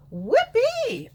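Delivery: high, loud cheer; whoopee cheer. Real, not acted. Vlogger cheering the flattening covid graph in a high, loud whoop. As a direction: cheer it out happily.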